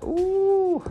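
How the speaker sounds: ordinary talk, nothing marked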